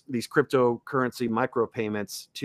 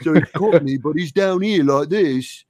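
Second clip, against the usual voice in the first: stoner alien; 3 semitones higher, 6 dB louder than usual. Acted. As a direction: drag it out like a spaced-out alien. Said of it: "two alien dudes that sound like stoners".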